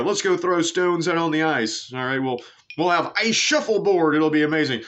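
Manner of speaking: hammed-up enthusiasm, voice lifted high; excited drunken proposal. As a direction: play an excited drunk selling a silly idea. Re-enacting drunk Scots inventing curling.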